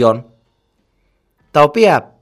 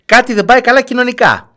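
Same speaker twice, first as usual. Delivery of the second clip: emphatic raised voice; 10 semitones higher, 6 dB louder than usual. Real, not acted. Radio host's emphatic point.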